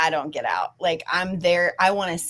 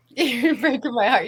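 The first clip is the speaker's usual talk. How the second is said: laughing, touched; 5 semitones higher than usual. Real, not acted. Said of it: "You're gonna make me cry".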